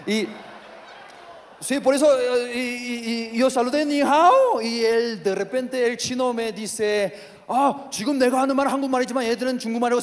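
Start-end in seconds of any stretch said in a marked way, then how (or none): none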